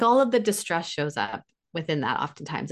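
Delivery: ordinary talk, nothing marked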